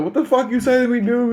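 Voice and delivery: imitating an outraged mother, very high-pitched; high-pitched mock confrontation; indignant retort. Mother challenging her son's answer back, indignant.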